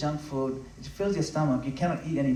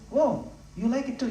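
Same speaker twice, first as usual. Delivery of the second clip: higher-pitched imitation of a Mombasa devotee accent; 8 semitones higher than usual. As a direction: lightly mimic the Mombasa devotees' accent, delighted. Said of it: "they'll say it in a different accent".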